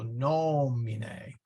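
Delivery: "nomine" slowly as NOOO-mi-ne; first-syllable stress. Shown as a demonstration. Teacher showing where accent goes, first-syllable stress. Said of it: "that accent goes there".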